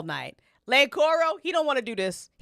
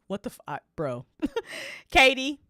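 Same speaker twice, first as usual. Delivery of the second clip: ordinary delivery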